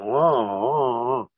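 imitating a hurt wrestler's groans, a touch of crying; pained groans. Kicked wrestler moaning on the apron, pained groans. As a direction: groan it out like you've just been kicked out of the ring.

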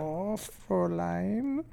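drawn-out, badly faked German accent; mock German accent. Shown as a demonstration. Co-host attempting a German Hitler, mock German accent. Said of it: "do your best Hitler German accent".

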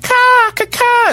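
very high, slow mocking crow caws; bird caw imitation. Mocking birds by the road, high slow caws. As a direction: caw like a jeering bird, drawn out. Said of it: "They're like".

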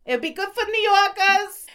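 high-pitched mock New York accent. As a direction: ham it up like a New Yorker. Repeats line in New York accent.

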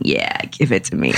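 man braving the storm. Retold or imitated. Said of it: "standing in a tsunami and a rainstorm and going".

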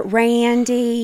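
put-on gentle Georgia accent; sweet Georgia drawl. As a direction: drawl the name like a Georgia belle. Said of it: "She was talking like she was from Georgia".

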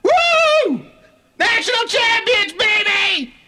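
high, slow, joyful shouting; victory shout. Acted. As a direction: yell like a champion celebrating. Celebrating a championship.

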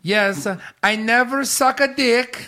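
put-on slow, high-pitched foreign-accent parody; mock Lana accent. Co-host plays Lana denying it, high mock accent. Playing Lana answering back.

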